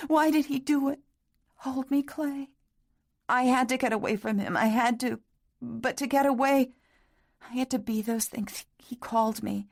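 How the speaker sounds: high, tearful confession; tearful young woman. A narrator's reading. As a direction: pour it out through sobs. Catherine sobbing into Clay.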